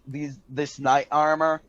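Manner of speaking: normal talking voice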